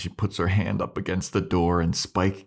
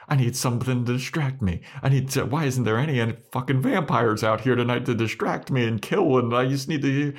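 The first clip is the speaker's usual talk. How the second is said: lightly voiced fretful Buffy, higher pitched; mock agitated self-talk, pitched up; monologuing to herself; 6 semitones higher than usual. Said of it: "she starts monologuing to herself".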